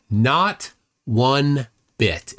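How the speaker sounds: very slow, word by word; slow, spaced-out verdict; emphatic dismissal. Real, not acted. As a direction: land each word separately to shut it down. Answering own rhetorical question.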